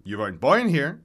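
put-on old man's loud scoff; lightly voiced old man, scornful. A narrator's reading. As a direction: dismiss your rival's boast. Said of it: "Meyer scoffs".

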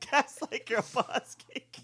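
laughing. Real, not acted. Laughing repeat of the phrase.

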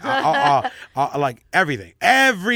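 laughing through the words. Real, not acted. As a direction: laugh it out, then a high loud final word. Laughing while listing his flaws.

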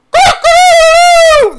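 very high, loud imitated rooster crow; shrill rooster call, shouted. Imitating rooster crowing at night.

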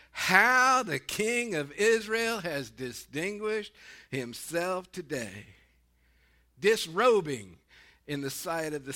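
slow, drawn-out mock admiration; dripping sarcasm. Preacher reads Michal scolding David on his return, sarcastically. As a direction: stretch each phrase with scornful sarcasm. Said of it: "I see such sarcasm".

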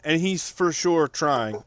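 trying drawn out slowly with weight; stress on trying. Shown as a demonstration. Co-host stressing trying about the director, slowly. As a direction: lean hard on the word trying. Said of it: "Emphasis on the word".

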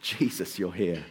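hushed, low awe; awed recognition. Retold or imitated. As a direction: whisper it in wonder.

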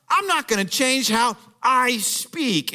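high, slow indignant protest; angry refusal. Acted. Offended missionary thinks to himself, indignant and stubborn. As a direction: dig in, stressing each word indignantly. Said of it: "but he was angry".

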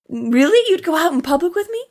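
fast, high, eager disbelief; excited disbelief. Retold or imitated. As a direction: gasp it out like he can't believe it.

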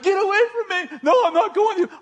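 high, urgent mock yell; retold yelling back. Girl yells back at the man chasing her, high urgent yelling. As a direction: push him off with a high-pitched cry. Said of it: "She's yelling something back".

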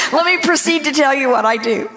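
words carried on a real laugh. Real, not acted. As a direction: laugh through it, amused at herself. Laughing at her own reaction.

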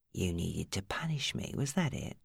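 soft, quiet threat; softest menace. A narrator's reading. Steve menacing Maddie, very soft tone. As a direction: purr the menace softly. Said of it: "in the softest tone".

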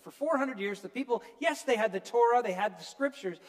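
normal talking voice

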